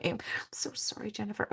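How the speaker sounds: whispered apology through a laugh. Real, not acted. Apologizing to Jennifer in the chat, laughing whisper. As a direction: breathe the sorry out laughing, no voice.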